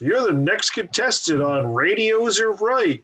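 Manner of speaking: high, slow, stretched-out contestant call; game show announcer. Acted. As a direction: announce the next contestant with drawn-out names and swooping pitch. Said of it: "on The Price is Right or something".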